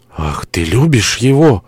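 high, drawn-out impassioned exclamation; crying out. Acted. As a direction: cry out slowly, overcome with emotion. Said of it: "вскричала госпожа Дореналь".

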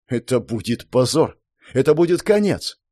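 usual speaking voice

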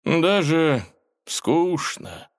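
high theatrical bored sigh; mock boredom. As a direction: sound grandly bored. Злодей скучает. (театрально скучающе)

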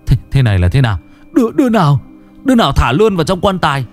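high stammering panic; panicked husband. Acted. Chiến clutching his wife's arm, high stammering panic. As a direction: stutter out the question in fright. Bấu lấy tay vợ.

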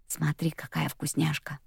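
soft coaxing voice; coaxing softly. A narrator's reading. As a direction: gently tempt a little child. Coaxing a toddler with cake.

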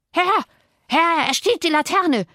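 put-on high, alarmed shout of an old man; high-pitched outraged yelling; old man's cry. A narrator's reading. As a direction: cry out to the master about a thief. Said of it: "schrie der Alte".

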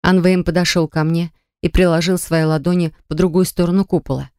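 plain reading voice

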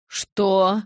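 loud gasped what; stunned, winded what; breathless shock. A narrator's reading. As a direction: choke out the word in disbelief. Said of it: "задохнулся Джейкоб".

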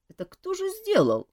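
amazed. A narrator's reading. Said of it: "изумился Жак".

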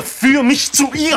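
slow, high, shouted command; a desperate man hammering home his demand; loud demand. Acted. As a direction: force each word out at her. Intruder insists on seeing lady.

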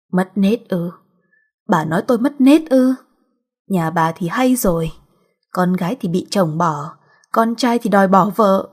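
usual speaking voice

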